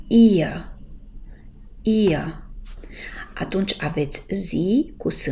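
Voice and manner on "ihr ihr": "Ihr" with a long i and the r only half sounded; ihr with half-swallowed r. Shown as a demonstration. Teacher demonstrates "Ihr" to students, long i, r half swallowed. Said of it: "îl pronunțați așa numai pe jumătate".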